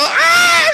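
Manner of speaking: very high loud panicked cry; terrified shriek. Real, not acted. Bird bursts at the man, loud terrified shriek. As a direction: scream in sudden fright.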